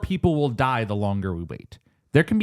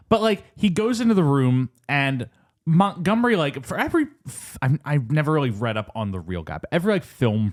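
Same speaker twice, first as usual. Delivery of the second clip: ordinary talk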